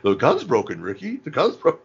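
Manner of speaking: imitating Bubbles. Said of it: "He's like".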